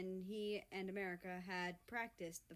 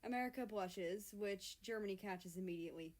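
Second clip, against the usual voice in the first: ordinary delivery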